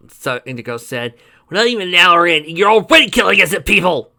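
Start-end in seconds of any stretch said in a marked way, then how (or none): 1.5-4.1 loud, high reproach; indignant scolding. A narrator's reading. Companion scolding killer friend, loud and indignant. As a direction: tell them off hard.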